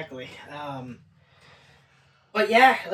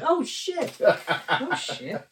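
higher, startled oh shit; surprised; 3 semitones higher, 3 dB quieter, much slower than usual. Retold or imitated. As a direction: caught off guard, then trailing down. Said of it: "I was like".

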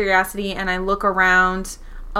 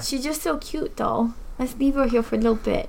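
cat voice; 3 semitones higher than usual. Real, not acted. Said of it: "That's my cat voice".

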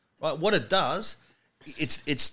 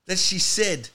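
usual speaking voice

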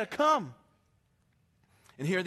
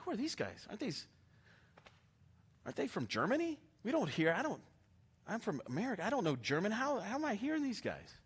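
softer, puzzled crowd voices; bewildered murmur; 2 semitones higher, 7 dB quieter, faster than usual. Retold or imitated. Festival pilgrims murmuring to each other, softer and puzzled. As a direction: murmur it like confused onlookers. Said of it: "they're going".

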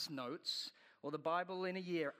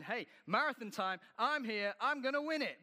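rising, louder, swaggering boast; cocky mock boast; 6 semitones higher, 5 dB louder than usual. Preacher mocking a cocky untrained runner, swaggering boast. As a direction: play the clueless braggart.

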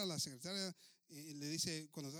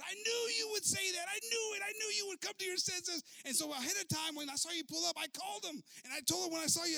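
put-on high, loud, gleeful old lady; delighted squeal; 10 semitones higher, 5 dB louder than usual. Secretary gushing that the enemy is on hold. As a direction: gush with triumph.

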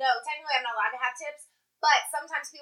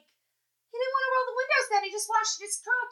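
high, disbelieving mock recount; amazed mock quote; incredulous retelling; 8 semitones higher than usual. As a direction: repeat the excuse like it's absurd. Retelling absurd excuse incredulously.

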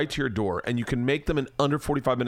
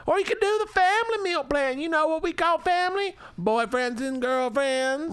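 hammed-up Southern accent. Host mocks wife reading meal plans in a Southern accent. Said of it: "I wish I hadn't done it in that accent".

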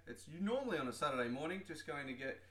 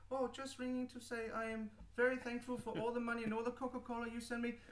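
higher put-on caller voice; 7 semitones higher than usual. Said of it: "a paid actor".

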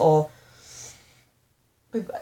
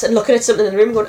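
normal talking voice

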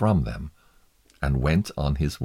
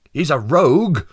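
high, loud accusation in character voice; declaring; 10 semitones higher, 9 dB louder than usual. A narrator's reading. Juror branding the prisoner a rogue, loudly declaring. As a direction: denounce him at full voice. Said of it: "Mister Liar declared".

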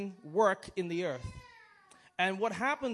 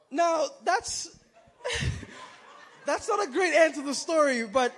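breaks into real laughter mid-sentence; can't hold back the laugh, high and loud; 7 semitones higher, 6 dB louder than usual. Real, not acted. Laughs at own joke's ending.

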